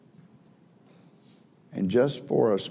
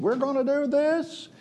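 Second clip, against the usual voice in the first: high, eager kid voice imitating a Little Rascal; little boy voice. Little Rascals boy rallying the gang, high kid voice. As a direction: squeak it out like a small boy rallying friends. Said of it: "one of the little boys said".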